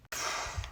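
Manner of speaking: long weary sigh; heavy sigh. Real, not acted. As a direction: let out a tired sigh. Exasperated sigh before complaint.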